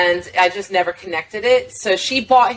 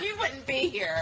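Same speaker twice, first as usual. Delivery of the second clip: laughing retort; 9 semitones higher, 12 dB quieter than usual. Retold or imitated.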